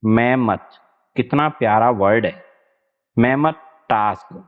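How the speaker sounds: normal talking voice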